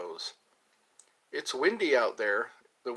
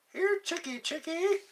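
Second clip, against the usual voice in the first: high sing-song farmyard call; calling chickens. Pretend. Joking streamer calls to women like chickens. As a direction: coax them in like feeding hens.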